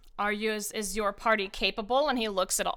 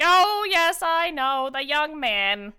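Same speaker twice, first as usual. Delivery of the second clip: put-on high, loud, doting old voice; fond old gnome; 8 semitones higher, 9 dB louder than usual. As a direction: gush about the young troublemaker. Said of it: "And he's like".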